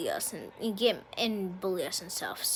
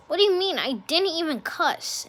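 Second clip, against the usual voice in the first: higher, louder disbelieving objection; retold outraged denial; indignant protest; 7 semitones higher, 4 dB louder than usual. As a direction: protest your innocence, pitched up.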